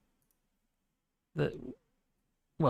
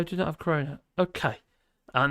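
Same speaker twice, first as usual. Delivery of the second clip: usual speaking voice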